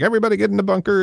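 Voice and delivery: put-on fast, high, old-timey announcer call; old newsreel narrator. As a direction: bark it like a vintage radio announcer. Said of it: "it sounds like an old-timey announcer mic".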